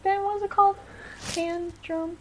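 ordinary talk, nothing marked

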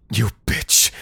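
screamed insult; berserk rage. Acted. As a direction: spit the insult out in fury. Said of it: "the nurse screamed".

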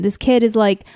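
usual speaking voice

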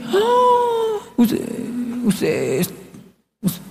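high cry collapsing into faint stammer; gasp, then lose the words as if appalled; mock shock. Latinos hearing a confession, mock shock.

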